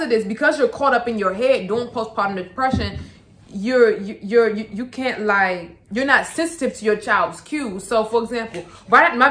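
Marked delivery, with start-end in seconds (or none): none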